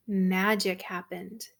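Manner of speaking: usual speaking voice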